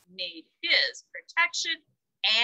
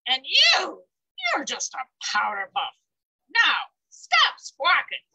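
bragging bully goat, loud and mocking; 3 semitones lower, 3 dB louder than usual. Big bully goat sneering at the ogre, loud brag. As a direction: throw your weight around, shout the threat.

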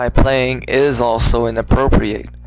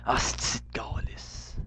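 fast whispered Quebec French curses; muttered swearing; 7 dB quieter than usual. Real, not acted. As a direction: whisper curses under your breath. Quebec swearing under breath.

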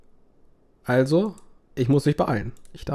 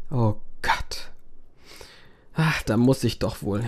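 ordinary talk, nothing marked

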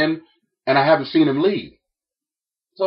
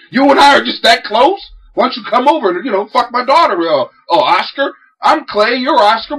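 sarcastic tirade; 6 semitones higher than usual. Retold or imitated. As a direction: sneer every line.

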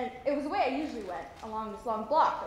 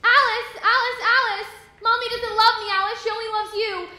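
high, very loud wail of a distraught sister; screaming and crying; 9 semitones higher, 11 dB louder than usual. Retold or imitated. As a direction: scream her name, then wail your hurt. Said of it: "screaming and crying".

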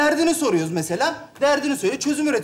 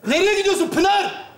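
high, raised-voice call; calling after someone; 7 semitones higher than usual. Acted. Calling after Pınar as she leaves.

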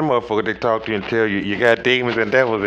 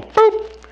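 high boop; 5 dB louder than usual. Acted. Playful sound effect mid-sermon.

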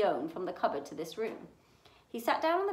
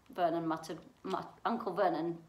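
plain reading voice

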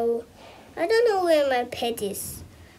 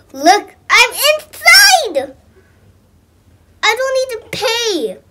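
excited; 8 semitones higher, 6 dB louder than usual. Real, not acted. Kid thrilled to get inside.

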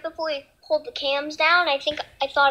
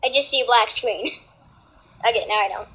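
ordinary delivery

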